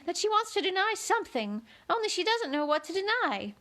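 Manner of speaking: high, fluttery queenly voice; a high, dithering old queen. A narrator's reading.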